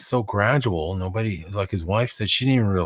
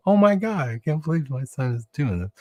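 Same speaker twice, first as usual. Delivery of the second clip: higher, shocked mother voice; mock dismayed mom; 7 semitones higher than usual. Mom reacting to her son's joke, mock shocked. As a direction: gasp like a scandalized mom. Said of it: "she was just like".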